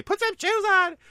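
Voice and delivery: put-on high, loud call after a child; mother yelling at barefoot son in snow, loud; yelling mom. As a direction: shout it after a kid running out. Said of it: "my mom yelling after me".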